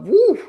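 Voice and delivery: high, loud whoop of delight; excited woo. Real, not acted. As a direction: let out a big happy whoop. Excited cheer after girls' clip.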